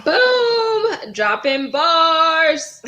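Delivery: high, loud, drawn-out hype cry; big stretched-out cheer; hype shout. Real, not acted. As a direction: shout it like the crowd just went wild. Hype after the rap.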